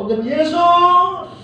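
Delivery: high, drawn-out cry to Jesus; acted high-pitched holler; calling out. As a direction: call after someone far ahead, stretching the name. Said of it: "mereka teriak".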